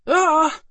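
loud, high-pitched acted howl; short shriek, struck in mid-air; high howl of pain. A narrator's reading. Alice hit by lightning in flight, howling. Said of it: "she howled".

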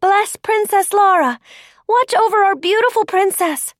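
high, loud blessing cried from a crowd; ringing high-pitched cheer; shouting. A narrator's reading. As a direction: call it out high and loud to a passing princess. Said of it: "they shouted".

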